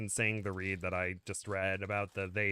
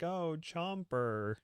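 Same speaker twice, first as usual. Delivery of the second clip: drawn-out, higher-pitched exclamation; fond recognition; 6 semitones higher, much slower than usual. Retold or imitated. Fan recalling a favourite dinosaur, fond recognition. Recalls fondly spotting a character.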